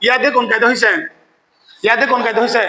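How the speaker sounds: normal talking voice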